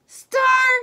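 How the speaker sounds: excited call. Acted. Director calling out the star.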